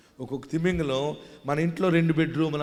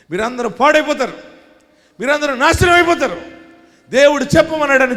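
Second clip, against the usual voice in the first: shouted warning, high and much louder, rushing at the end; prophet's doom warning; 10 semitones higher, 8 dB louder, faster than usual. Acted. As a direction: proclaim doom on the whole city. Jonah proclaiming doom in Nineveh.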